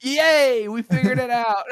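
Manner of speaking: high, loud, gleeful mock cheer; mock jubilation. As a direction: celebrate with silly over-the-top triumph. Mock celebration of silly answer.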